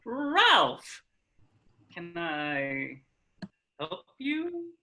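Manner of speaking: awkward politeness. Acted. Felix to uninvited Ralph at the door, awkwardly polite. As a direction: greet him brightly, then hesitate between words.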